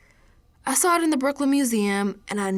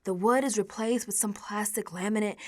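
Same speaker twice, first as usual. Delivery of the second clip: ordinary delivery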